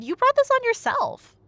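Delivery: usual speaking voice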